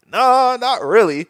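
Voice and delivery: high, slow mock-meek no; sheepish admission. S0 replays his answer to Cosign, high and slow. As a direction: own up with a sheepish drawl. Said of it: "I was like".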